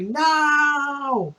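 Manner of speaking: long, high drawn-out wail; stretched-out high mock scream; acted cry of horror. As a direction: imitate a helpless scream of protest. Retold woman witnessing a killing.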